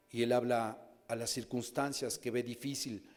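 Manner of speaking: normal talking voice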